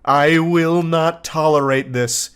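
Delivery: slow, measured indignant declaration; acted fury. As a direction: draw out each word in restrained mock fury. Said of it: "this fury".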